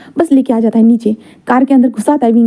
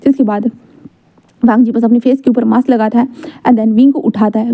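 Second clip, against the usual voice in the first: usual speaking voice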